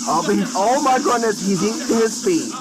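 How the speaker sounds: high-pitched exclamation of disbelief; amused shock. Real, not acted. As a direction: react aghast, voice jumping up. Shocked at sim being eaten.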